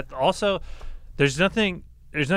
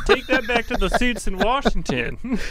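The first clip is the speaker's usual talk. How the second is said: high, laughing protest; hammed-up complaint breaking into laughter; mock-indignant trooper; 12 semitones higher, 5 dB louder than usual. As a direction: play along as an outraged trooper, voice pitched way up.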